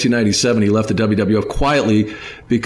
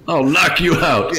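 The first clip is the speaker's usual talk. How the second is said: mock threat; 6 semitones higher, slower than usual. Mimicking Jim fired up.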